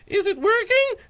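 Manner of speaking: squeaky high voice, imitating himself; high squeaky quote. To friend, retelling the answering machine, squeaky high. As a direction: pitch way up, mock nervous. Retelling recording phone message.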